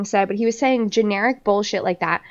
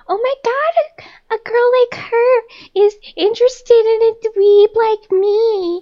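much higher mocking dweeb voice; mocking Brayden; 11 semitones higher, 4 dB louder than usual. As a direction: put on a high whiny fake-humble voice. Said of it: "and he was like".

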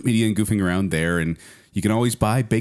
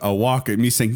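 ordinary talk, nothing marked

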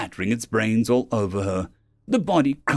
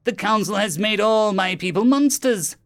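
high-pitched, impassioned villain rant; aggrieved alien villain; furious alien captain; 10 semitones higher, 3 dB louder than usual. Acted. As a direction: rail against the council's injustice.

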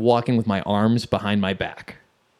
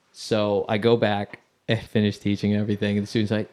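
ordinary delivery, nothing marked

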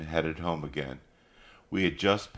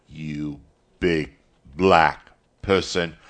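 halting, word by word, building louder; sputtering anger; 5 dB louder, slower than usual. A narrator's reading. Caleb Wallace confronting Mister Morrison, sputtering anger. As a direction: sputter it out in broken pieces. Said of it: "then sputtered".

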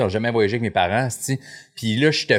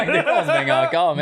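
high, squeaky laugh through the words. Real, not acted. Father recounting beach fatigue while laughing in disbelief.

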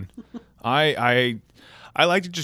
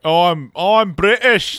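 mock claim, rising higher and louder; mock Scot; 5 semitones higher, 4 dB louder, slower than usual. Mocking the Scottish dad who calls himself British, louder. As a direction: play the Edinburgh man insisting he's British. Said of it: "and he's like".

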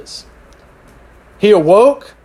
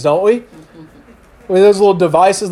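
ordinary delivery

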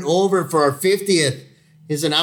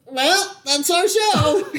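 higher, slow, laughing; much slower than usual. Real, not acted. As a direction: stretch it out laughing. Laughing sign-off joke.

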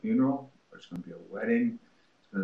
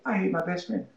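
higher, plain demo; 10 semitones higher than usual. Shown as a demonstration. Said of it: "Just say".

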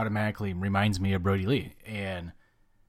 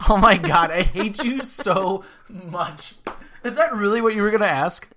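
laughing exasperation; 10 semitones higher, 8 dB louder than usual. Real, not acted.

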